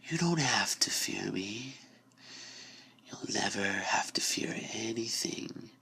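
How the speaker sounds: slow, soft, soothing whisper of victory; the door approving her step, whispered softly. Acted. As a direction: hush her gently toward the end. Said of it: "The voice whispered its approval".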